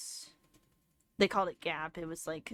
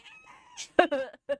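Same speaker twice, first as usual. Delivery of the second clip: a loud, real laugh that breaks out; burst of laughter; 5 semitones higher, 8 dB louder than usual. Real, not acted. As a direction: crack up at the absurd note. Reading the prom note aloud.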